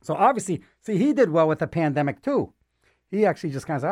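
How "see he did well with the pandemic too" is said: ordinary talk, nothing marked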